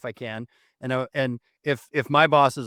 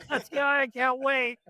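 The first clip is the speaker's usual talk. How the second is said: high-pitched, helpless laughter through the words; laughing; 13 semitones higher, slower than usual. Real, not acted. Host delighted by the dad impression, laughing hard. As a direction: squeal it out while cracking up.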